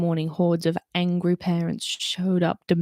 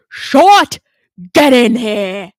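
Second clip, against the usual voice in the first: much louder bark, higher on the name; commander's yell; 4 semitones higher, 14 dB louder, much slower than usual. Acted. Commander Root yelling Holly into his office, loud. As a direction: bellow her name through the office.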